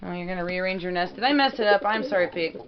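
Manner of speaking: ordinary talk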